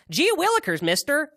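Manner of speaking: put-on high, loud Andy Griffith kid; gee-whiz small-town boy; wholesome 1950s kid. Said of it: "And he kinda talked like that".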